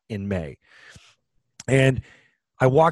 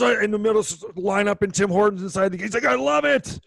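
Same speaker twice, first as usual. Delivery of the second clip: put-on higher, eager old-timer voice; excited old man; 8 semitones higher than usual. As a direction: keep the lifted excited voice. Old man quote continues.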